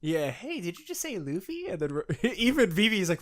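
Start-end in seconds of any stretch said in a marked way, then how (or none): 0.3-1.7 lightly voiced high girlish Rebecca, quieter. Fan retelling a chapter, voicing Rebecca's high eager question. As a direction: squeak it like an eager young girl.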